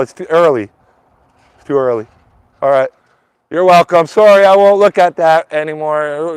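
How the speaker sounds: high, loud call across the yard to a neighbor; loud, high call to the angry neighbor. Real, not acted. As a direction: project it over to the neighbor's yard.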